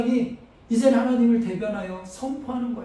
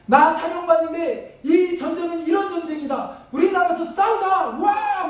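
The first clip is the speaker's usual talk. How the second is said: rallying commander; 6 semitones higher than usual. Pretend. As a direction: rouse the troops before battle. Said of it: "막 이래야 되는 거 아닙니까".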